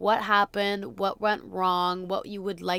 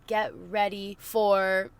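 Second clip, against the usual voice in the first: ordinary talk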